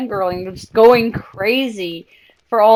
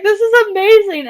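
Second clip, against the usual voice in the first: laughing joy; 11 semitones higher, 4 dB louder than usual. Retold or imitated. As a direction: gush through laughter. Overwhelmed by friends' help.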